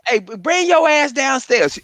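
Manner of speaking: high shouted call from a mother; mock mom yelling; mama yelling down. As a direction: holler like an angry mom upstairs. Mama calling him down.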